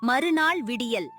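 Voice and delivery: usual speaking voice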